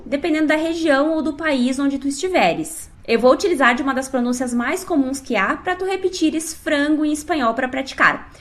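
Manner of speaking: ordinary talk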